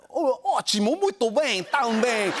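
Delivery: high, bright party-feel Brazilian Portuguese; like inviting everyone to the party. Shown as a demonstration. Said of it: "Brazil, that's Portuguese".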